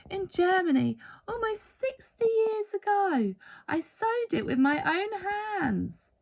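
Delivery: a hint of a frail old lady near tears; old woman remembering. A narrator's reading. Old woman's tearful memory.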